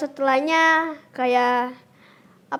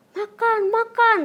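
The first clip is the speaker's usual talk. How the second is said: firm but restrained nudge, pitched up; slightly raised voice; 8 semitones higher than usual. Retold or imitated. Child retells caregiver telling them to eat, slightly raised voice. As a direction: imitate a caregiver urging food, only a little forceful. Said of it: "cuma dikencengin dikit".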